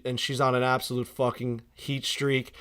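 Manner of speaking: ordinary talk, nothing marked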